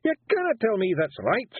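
high, Scottish Feegle warrior protesting; high Scots pixie voice wheedling his way out. A narrator's reading. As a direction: plead like a small nervous Scottish warrior. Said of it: "said Rob Anybody".

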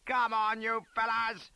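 high, loud rallying yell; final yell. A narrator's reading. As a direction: yell to the whole regiment to follow. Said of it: "gave one final yell of".

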